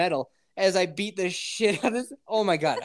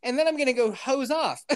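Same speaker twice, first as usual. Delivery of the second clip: laughing; 9 semitones higher than usual. Retold or imitated. Excited about finding a sauna.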